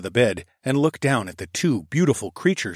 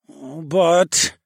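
higher, louder whine; whining; 6 semitones higher, 4 dB louder than usual. A narrator's reading. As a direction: whine like a sulky child. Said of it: "I whined".

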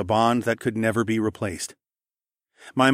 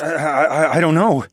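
flustered, louder, stumbling words; stammering; 6 semitones higher, 6 dB louder than usual. A narrator's reading. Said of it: "I stammered".

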